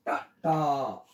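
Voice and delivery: टा, टा drawn out very slowly; slow demo. Shown as a demonstration. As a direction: stretch each टा slowly for the learner. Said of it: "इसका उच्चारण टा आता है". (धीरे-धीरे टा, टा बोलें)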